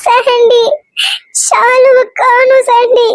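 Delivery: a frightened friend calls Sandy, sobbing down the phone; tearful phone call. Acted. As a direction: choke out the news through tears. Friend calls, sister is missing.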